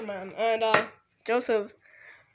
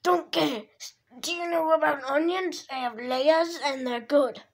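Shrek voice; 4 semitones higher than usual. Retold or imitated. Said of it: "Do the, um, Shrek".

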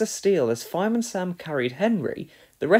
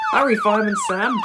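quick, raised, loud voice; urgent urging; 7 semitones higher, 5 dB louder, faster than usual. A narrator's reading. Officer to fireman racing to a fire, urgent urging. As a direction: hurry someone along, fast and high. Officer urges over siren.

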